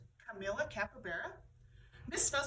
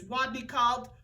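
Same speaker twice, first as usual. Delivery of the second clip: ordinary delivery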